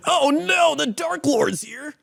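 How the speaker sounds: mock panic. Hero reacts in skit.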